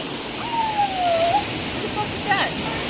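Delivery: startled. Real, not acted. Sudden startled reaction.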